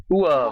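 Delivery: "Uovo" loudly imitated in Italian; Italian pronunciation. Likening the translation to Hoover, "Uovo" said as Italian. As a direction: echo "Uovo" like the Italian playback. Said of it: "what that sounds like in Italian".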